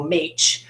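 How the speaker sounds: "mach" said with long A, like "maych"; long A, wrong reading. Shown as a demonstration. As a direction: make "mach" rhyme with the letter H. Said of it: "it's never gonna go".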